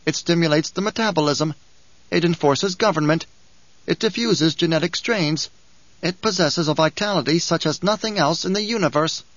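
a sly little dwarf preaching the uses of war; sly dwarf Bijaz. Acted. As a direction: lecture like a gleeful little schemer. Dwarf Bijaz lectures Hayt.

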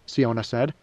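usual speaking voice

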